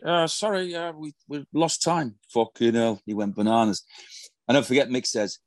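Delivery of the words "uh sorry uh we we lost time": much higher, mock-meek apologetic voice; sheepish excuse. Players caught by the manager behind the curtain, mock-meek high excuse. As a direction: play the caught-out lad squeaking an excuse.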